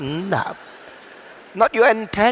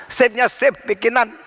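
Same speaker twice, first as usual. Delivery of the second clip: ordinary talk, nothing marked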